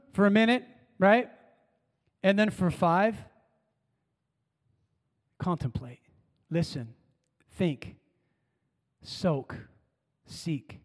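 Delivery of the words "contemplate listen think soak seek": soft, slow, fading list; hushed. Acted. Preacher gently modelling five minutes of quiet listening. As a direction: drop to a near hush, savouring each word.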